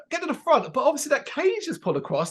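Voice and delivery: high, baffled repeating; incredulous. Retold or imitated. To himself, stuck inside the venue, high and baffled. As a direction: sound trapped and disbelieving. Locked in the underworld.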